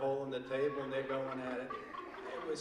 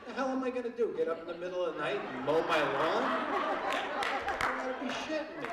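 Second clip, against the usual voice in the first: incredulous; 6 semitones higher, 4 dB louder than usual. Real, not acted. Mocking headlights on riding mowers.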